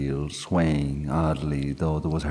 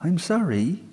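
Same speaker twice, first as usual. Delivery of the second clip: stammered. A narrator's reading. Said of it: "Grania stammered".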